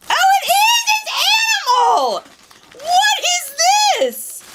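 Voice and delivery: high, loud delighted shout; thrilled cry of recognition; excited shout. Real, not acted. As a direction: shriek with joy at a favourite character. Recognizes Animal in the box.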